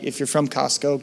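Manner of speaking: ordinary talk, nothing marked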